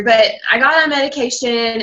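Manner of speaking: normal talking voice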